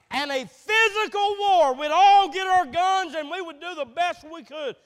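loud rising preacher's call to arms; rally the men like a war sermon, loud and building; preaching at full force. Acted. Preacher rallying the men to arms, loud preaching.

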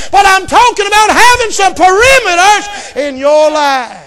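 loud, driving preaching, often fast; preaching at full force. Acted. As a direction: hammer the rules home to the church. Preacher laying down youth rules.

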